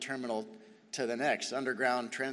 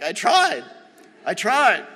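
loud, higher-pitched mock protest; exasperated protest; 6 semitones higher, 10 dB louder, slower than usual. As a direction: plead your case loudly, hammed-up. Retold protest at being scolded.

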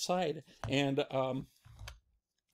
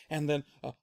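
ordinary delivery, nothing marked

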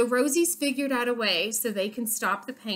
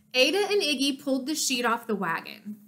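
plain reading voice

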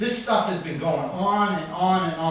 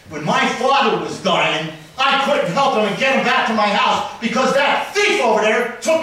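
real furious shouting at the council, much louder and higher; angry loud talking; 4 semitones higher, 6 dB louder than usual. Real, not acted. Resident accusing the council at public comment, real angry shouting. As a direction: rail at the officials at full volume. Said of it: "Sorry for talking loud".

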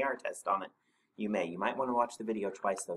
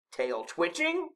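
higher, slow incredulous echo; snorted retort; 8 semitones higher, 3 dB louder, slower than usual. A narrator's reading. Snorklum answering Rabbit, slow snorted disbelief. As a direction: toss it back as an affronted question. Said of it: "snorted the Snorklum".

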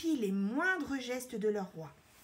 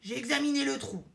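quick, raised, louder reply; defensive retort; 4 semitones higher, 5 dB louder, faster than usual. A narrator's reading. Embarrassed lion defending himself to the queen, retorting. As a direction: justify yourself hastily. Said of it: "embarrassé par ces paroles, rétorque".

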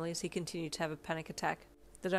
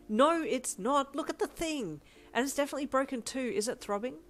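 insistent alarm; 9 semitones higher, 7 dB louder than usual. A narrator's reading. Insisting the arm is broken.

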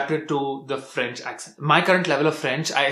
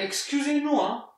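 much higher, mocking voice of a French clerk; snooty French clerk; 10 semitones higher, 4 dB quieter than usual. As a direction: play a prissy Parisian clerk apologising. Skit, high put-on voice.

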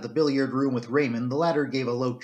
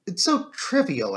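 higher, slow, apologetic protest; apologetic exclamation; 6 semitones higher, slower than usual. A narrator's reading. Doctor to inspector about a trivial detail, apologetic. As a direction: play it down apologetically, pitched up. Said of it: "I exclaimed apologetically".

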